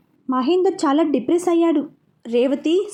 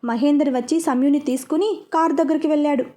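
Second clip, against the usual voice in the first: plain reading voice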